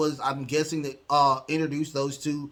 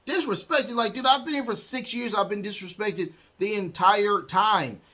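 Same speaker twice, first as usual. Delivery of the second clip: mock disbelief, starting much higher; incredulous comeback; 5 semitones higher than usual. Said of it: "He's like".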